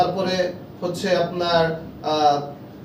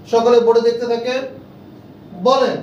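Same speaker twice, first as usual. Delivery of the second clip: louder, higher call to the whole room; calling the class; 4 semitones higher, 5 dB louder than usual. Shown as a demonstration. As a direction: call the students to look and repeat. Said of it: "teacher calls class to board". (জোরে ক্লাসকে ডাকা)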